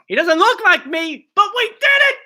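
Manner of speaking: rising high triumphant shout; excited shout. Real, not acted. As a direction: cheer it out triumphantly. Celebrating his crab.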